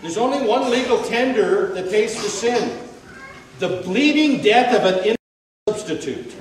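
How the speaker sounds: loud, fast rising proclamation; fervent preaching. Acted. Preacher proclaiming the only payment for sin, loud. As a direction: proclaim it with full force.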